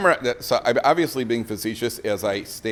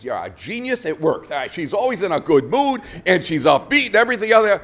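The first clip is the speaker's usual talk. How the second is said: delighted report, rising higher and louder; acted-out happy customer, getting louder; excited husband; 5 semitones higher, 4 dB louder than usual. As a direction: build into gushing, amazed praise. Delighted husband reporting back to Rav.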